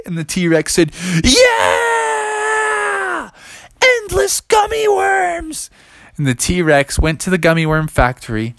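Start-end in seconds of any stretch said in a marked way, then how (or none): 1.2-5.7 very high, drawn-out whoop; hammed-up dinosaur jubilation; ecstatic shout. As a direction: scream it like an overjoyed T-Rex. T-Rex thrilled by factory job.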